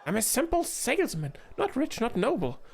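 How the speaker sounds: a little gnome insisting he's nobody; defensive gnome. Acted. Gnome merchant protesting to the queen, agitated.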